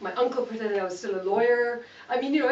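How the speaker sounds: ordinary delivery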